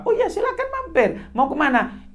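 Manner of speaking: high, friendly reply; lightly voiced father. Father answers young man at airport. (sedikit suara ayah, ramah dan tinggi)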